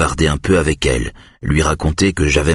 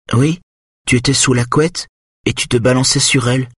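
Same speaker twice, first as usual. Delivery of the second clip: put-on high little boy's voice; clear, high voice of a young son; clear, high-pitched child; 9 semitones higher than usual. A narrator's reading. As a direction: pitch it up like a small child piping up. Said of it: "sa voix claire et haut perché".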